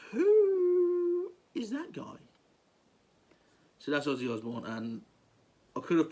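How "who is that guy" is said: long, high, stretched-out 'who'; slow, high-pitched mock amazement; acted awe. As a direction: draw out the first word in exaggerated wonder. Voicing awestruck onlookers, long high who.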